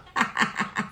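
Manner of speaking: soft run of laughter; amused laugh. Real, not acted. Preacher amused by a borrowed saying, soft laugh. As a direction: laugh softly at your own quip.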